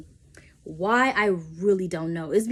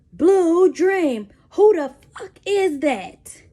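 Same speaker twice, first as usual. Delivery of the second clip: loud, much higher, slowed demand; mock angry interrogation; 8 semitones higher, 8 dB louder, much slower than usual. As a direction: bark it like a jealous interrogator. Boyfriend interrogating her, bottle mic.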